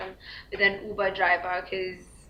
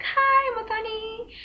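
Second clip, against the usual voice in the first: high, slow, sing-song "Hi, Muthoni"; over-friendly driver; 13 semitones higher, slower than usual. Retold or imitated. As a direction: mimic his overly familiar high greeting. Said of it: "It was that high of".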